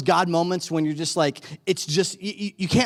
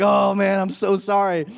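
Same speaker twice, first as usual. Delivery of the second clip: backtracking apology; 5 semitones higher, 5 dB louder than usual. Retold or imitated. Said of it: "you start backtracking".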